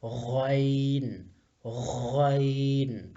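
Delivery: غین held very slowly from the throat; drawn-out letter name. Shown as a demonstration. Teacher to pupils, sounding out غین slowly. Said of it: "اس کو ہم غین پڑھیں گے".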